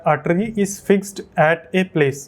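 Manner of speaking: slow, word by word English reading; slow reading aloud. Shown as a demonstration. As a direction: read each word out carefully. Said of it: "इसको अगर हम पढ़ें". (धीरे धीरे पढ़ना)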